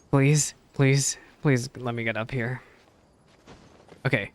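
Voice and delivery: normal talking voice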